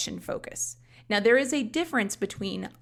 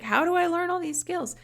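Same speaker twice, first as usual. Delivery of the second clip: high, mock-baffled question; a learner wondering aloud, in a high, puzzled voice; mock puzzled student; 10 semitones higher than usual. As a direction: squeak it like a lost learner. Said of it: "if you're like".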